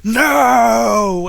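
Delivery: loud, high hammed-up wail; acted wailing. Fan falls to her knees, melodramatic wailing. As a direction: wail it slowly, like a heartbroken fan. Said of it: "falls to her knees wailing".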